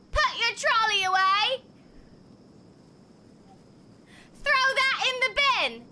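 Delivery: high, loud hammed-up British orders; mock British yell. English people ordering shoppers about, mock British yell. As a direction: boss them about like a comic Brit. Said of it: "And they go".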